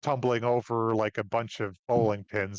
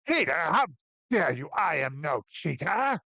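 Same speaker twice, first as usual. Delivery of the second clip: indignant dwarf; 3 semitones higher than usual. Acted. Dwarf accused of cheating.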